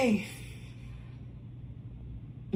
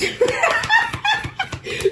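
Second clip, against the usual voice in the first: high laugh; 4 dB louder than usual. Real, not acted. Laughing out loud.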